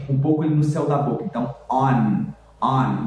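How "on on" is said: on ending with the tongue touching the front teeth; slow on finishing on a firm N; clear final N. Shown as a demonstration. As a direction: land on the teeth for the N in on. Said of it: "com a língua ali quase encostando no dente".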